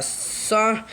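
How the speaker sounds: ordinary delivery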